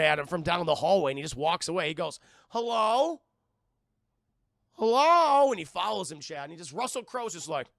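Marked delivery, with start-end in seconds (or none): 2.5-3.2 loud, high, rising hello; questioning call-out. Retold or imitated. Acts out calling after figure. 4.7-5.5 very loud, high, drawn-out hello, falling; full shouted call. Retold or imitated. Calls again after a pause.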